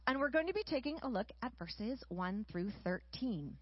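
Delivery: ordinary talk, nothing marked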